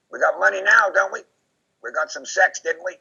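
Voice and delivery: high, loud jeering gloat; mock gloating killer. Imitating Henry gloating after a crime, loud high jeer. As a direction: crow about it, hammed-up glee. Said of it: "then he'll laugh".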